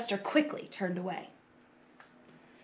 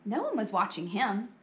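ordinary delivery, nothing marked

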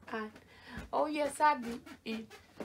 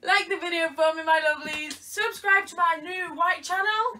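playful host voice; 6 semitones higher, 5 dB louder than usual. Acted. Playful outro pitch.

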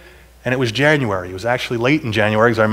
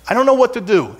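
distressed; 8 semitones higher than usual. Retold or imitated. Despair retold.

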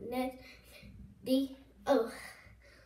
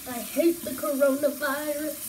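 louder, stressing hate; loud complaint; 9 dB louder than usual. Real, not acted.

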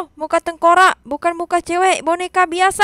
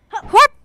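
short high effort grunt; jumping grunt; 6 semitones higher, 5 dB louder than usual. Real, not acted. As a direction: grunt as you leap. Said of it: "jumping on glass".

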